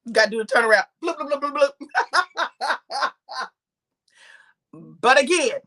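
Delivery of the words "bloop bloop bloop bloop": quick bouncy run of bloops; playful bloop sounds. Shown as a demonstration. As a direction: voice a turn with little bloops. Voicing the turnaround move.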